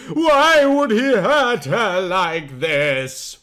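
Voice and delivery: ordinary delivery, nothing marked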